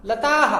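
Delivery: "लताह" loud, long aa released into ha; correct visarga. Shown as a demonstration. Teacher repeating the correct reading, pronounced demonstration. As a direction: say "लताह" firmly as lataa-ha. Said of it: "आ का ही उच्चारण इसमें".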